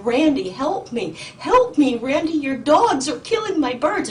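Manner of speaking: loud, high pleading yell at the neighbor; retold scream for help. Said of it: "I was screaming".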